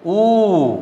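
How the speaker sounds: ऊ drawn out slowly; long vowel ऊ. Shown as a demonstration. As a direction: hold ऊ long for the class. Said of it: "उ के बाद". (ऊ को खींचकर बोलें)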